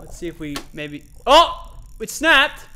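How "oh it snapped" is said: high, loud yelp of dismay; startled, dismayed shout; shocked outcry. Real, not acted. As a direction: cry out as if it just broke. Line snaps suddenly.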